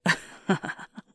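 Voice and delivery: bursting, breathy giggle; surprised laugh. Acted. Partner's stomach rumbles mid-moment, surprised laugh. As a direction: crack up suddenly.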